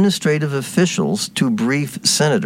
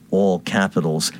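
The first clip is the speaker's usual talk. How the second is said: ordinary talk, nothing marked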